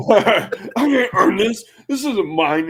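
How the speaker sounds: high, laughing mock protest; hammed-up refusal of free money; mock panicked John. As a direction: squeal it out like you can't believe it. Acting out John's confusion.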